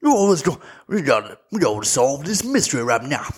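hammed-up Southern detective; generic Southern accent. Imitating Daniel Craig's detective, hammed-up Southern accent. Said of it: "Just, like, a Southern".

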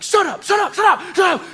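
high-pitched frantic shouts of shut up; panicked yelling. Retold or imitated. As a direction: scream at the friends to stop. Yelling at noisy friends.

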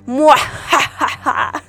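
slow hammy evil cackle; sneering panto villain laugh. Acted. Spooky Halloween episode intro.

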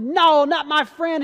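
high, loud, drawn-out NO; loud storyteller's yell, words stretched; retold shout of protest. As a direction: cry out to defend a friend. Peter draws sword to defend.

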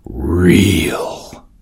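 breathy whispered word; whispered narration. A narrator's reading. Narrator whispering his heart's reeling. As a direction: let it fall to a whisper.

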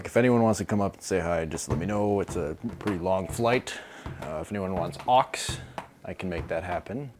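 chatty pilot. Acted. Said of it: "keeps making small talk over the speaker".

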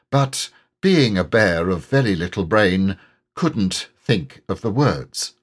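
usual speaking voice

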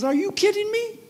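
the robber can't believe anyone would run; incredulous robber. Retold or imitated. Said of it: "He goes".

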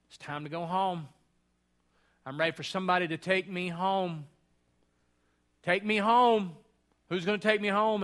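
a lightly voiced elderly mother begging to go home; confused mother pleading. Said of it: "and my mother will go".

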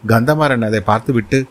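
usual speaking voice

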